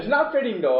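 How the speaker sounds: high and laughing. Real, not acted.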